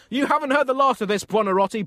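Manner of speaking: high, loud angry threat; blasting. A narrator's reading. Furious Pope threatens the painter, blasting him. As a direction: blast it at him in comic fury.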